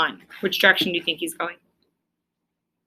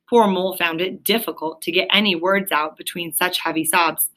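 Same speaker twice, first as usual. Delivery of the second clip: usual speaking voice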